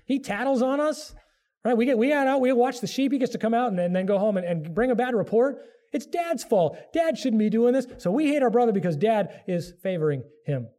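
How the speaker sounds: high, resentful griping of jealous siblings; jealous brothers. Retold or imitated. Joseph's brothers complaining about their father's favouritism, high and whiny. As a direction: gripe like a sulky teenager about the favourite. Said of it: "you can hear the brothers".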